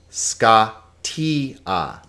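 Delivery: Scatia broken slowly into ska, tee, ah; three separate syllables. Shown as a demonstration. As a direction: give each vowel in Scatia its own syllable. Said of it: "each vowel gets its own syllable".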